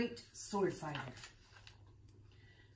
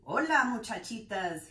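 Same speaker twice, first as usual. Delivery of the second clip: ordinary delivery, nothing marked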